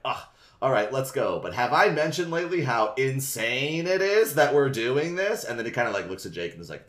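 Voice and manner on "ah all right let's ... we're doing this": hammed-up forced cheer; over the top. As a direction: overplay the enthusiasm on purpose. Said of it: "over the top is like".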